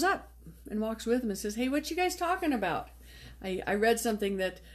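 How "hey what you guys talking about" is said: lightly voiced Jesus, breezy and higher; casual Jesus. Jesus casually greeting the two disciples, breezy and higher. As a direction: toss it off like a friendly stranger.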